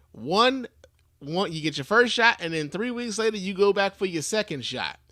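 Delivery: usual speaking voice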